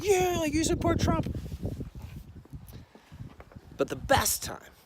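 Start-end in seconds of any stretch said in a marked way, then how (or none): 0.0-1.4 high mocking put-on voice; mocking guy. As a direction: sneer it out way up high. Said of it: "somebody who was going like".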